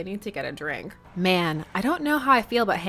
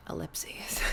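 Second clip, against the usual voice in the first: breathy whisper, much softer; 2 semitones lower, 12 dB quieter than usual. Acted. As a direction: whisper it aside. Reading dots in a whisper.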